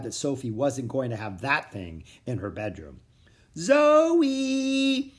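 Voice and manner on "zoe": high, loud sing-song calling out; small creature calling Zoe in sing-song; sing-songy call. A narrator's reading. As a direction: call her name in a lilting little voice. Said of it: "a little sing-songy voice called out".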